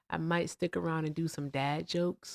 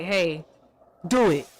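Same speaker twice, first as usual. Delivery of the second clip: a sharp, louder order; commanding; 6 dB louder than usual. Retold or imitated. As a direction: bark it as a demand. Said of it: "The other was like".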